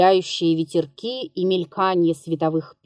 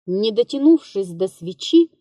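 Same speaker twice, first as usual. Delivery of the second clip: ordinary delivery, nothing marked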